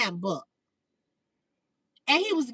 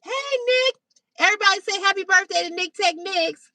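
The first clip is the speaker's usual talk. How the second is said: high, cheery calling out; rally everybody to greet the newcomer; excited shout-out; 7 semitones higher, 3 dB louder, faster than usual. Real, not acted. Host calls on viewers to greet Nick's birthday, excitedly.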